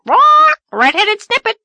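shrill high loud squawk; squawking parrot. Acted. Parrot keeps interrupting Anne, high loud squawk. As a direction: screech an insult like a parrot. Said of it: "kept ejaculating".

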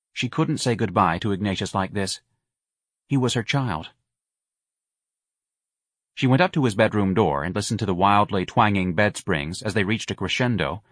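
plain reading voice

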